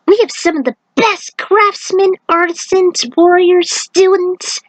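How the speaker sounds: put-on high, eager young monk boasting of his academy; enthusiastic Jin. Jin proudly tells Aiko about the academy, high and eager. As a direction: lift it way up, proud and excited. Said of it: "Jin explained with enthusiasm".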